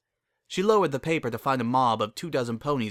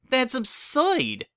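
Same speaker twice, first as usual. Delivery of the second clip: high, slow outraged drawl; indignant; 14 semitones higher, much slower than usual. Acted. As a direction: stretch it out in disbelief. Bon Bon indignant.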